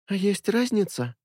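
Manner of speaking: high, slow cool retort; unbothered girl asking; girl's voice. A narrator's reading. Redhead's cool reply. (девичий голос, холодно)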